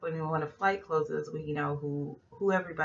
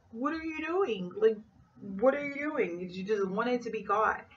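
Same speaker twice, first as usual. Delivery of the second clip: high, incredulous what are you doing; exasperated; 5 semitones higher than usual. Retold or imitated. Vlogger scolds the blatant thief, high exasperation. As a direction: throw up your hands in disbelief.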